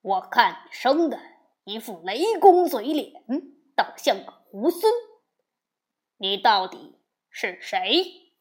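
loud, slowing to the end; angry shout. Acted. Demon king shouting angrily at Wukong. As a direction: bark out the insult and demand. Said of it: "喝道".

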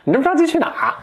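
hammed-up bratty dwarf taunting the hurrying prince; sneering dwarf. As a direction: squeeze it up high and mocking, like a nasty little imp. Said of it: "这侏儒很贱".

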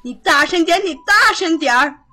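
loud, high bark; bullying shout. Acted. Nanny bullies the maid to speak up, shouting. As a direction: yell the order at her.

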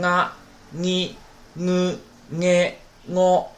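啊、你、呢、呢、我 as nga, ngi, ngu, nge, ngo; nasalized g-row. Shown as a demonstration. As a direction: model the Japanese nasal g in 啊、你、呢、呢、我. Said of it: "你都加一个嗯".

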